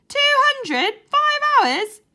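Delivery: high, loud disbelieving yelp; shocked cry. A narrator's reading. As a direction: squeal it out in utter disbelief. Joe shocked by two hundred calls.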